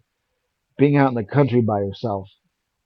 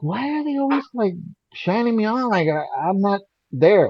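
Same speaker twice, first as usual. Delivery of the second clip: retold bafflement; 8 semitones higher than usual. Retold puzzlement at being ignored.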